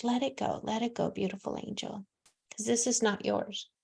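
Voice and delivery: soft, hushed, calming; soothing release. Acted. Psychic gently soothing a grieving client, soft and hushed. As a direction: ease her worries away gently.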